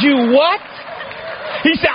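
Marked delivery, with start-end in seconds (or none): 0.0-0.6 higher, incredulous mock reaction; retold disbelief. Son reacts to Dad's marriage news, acted disbelief. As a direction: blurt it as a stunned son, playfully.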